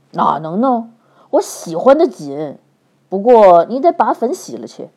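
reassure, then order; gruff man. A narrator's reading. Li Jing soothes then demands.

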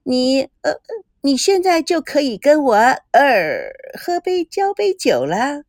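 high, slow mock-drunk coyness; a hint of tipsy flirting, slowed at the end; a sister teasingly pretending to be drunk, high and coy; sarcastic coy. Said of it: "讽刺性的撒娇".

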